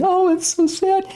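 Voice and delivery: high, gushing mock-sentimental sigh. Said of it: "pure emotionalism or sentimentality".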